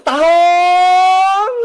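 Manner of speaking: long drawn-out selling cry; vendor's call. Acted. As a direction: stretch the word out like a street hawker. Closing street vendor call.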